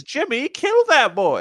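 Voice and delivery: high-pitched, drawn-out order; acted command to attack. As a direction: play a store worker siccing Jimmy on the kid. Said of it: "She, she points and says".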